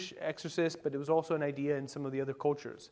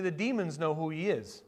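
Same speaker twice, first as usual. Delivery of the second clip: usual speaking voice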